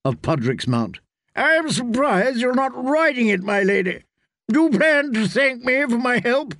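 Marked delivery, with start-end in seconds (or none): 1.3-6.5 mocking jibe, pitched noticeably higher; a lightly voiced mocking knight. Sir Hyle mocking Pudrick's horse to Brienne, pitched-up teasing voice. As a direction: tease her about the ugly horse.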